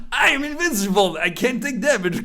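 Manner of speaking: high-pitched mock boast; exaggerated, jeering quote; mocking imitation. Mocks teammates' boastful thinking, then retreat.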